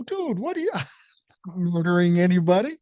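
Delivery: high-pitched, disbelieving "dude" reaction; retold incredulity. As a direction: squeak it out in mock alarm. Retold incredulous reply to friend's warning.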